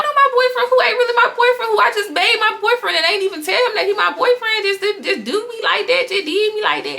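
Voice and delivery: high-pitched mock-hurt complaint; offended. Girl offended that her boyfriend did her like that, high complaint. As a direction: whine in a high voice, acting wronged. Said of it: "I'm offended".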